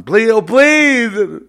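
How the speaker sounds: high, loud, stretched-out wail; mock begging. Mocking those who beg God, mock wailing. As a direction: wail it as a hammed-up plea. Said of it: "don't say please all you want".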